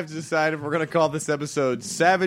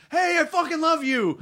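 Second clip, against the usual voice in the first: retold shout; 10 semitones higher than usual. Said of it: "this guy shouted at me from across the street".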